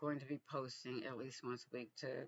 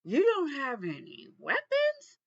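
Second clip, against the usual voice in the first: much higher, louder, slow astonished question; shocked disbelief; 11 semitones higher, 12 dB louder than usual. Retold or imitated. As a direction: play the stunned border officer who can't believe it. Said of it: "he was so shocked".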